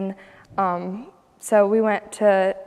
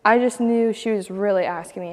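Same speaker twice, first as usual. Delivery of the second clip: ordinary talk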